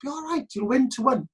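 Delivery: imitating a reassuring companion in a high voice; high-pitched mock reassurance; Vicky. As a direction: pitch it up to mimic her optimism. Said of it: "And she went".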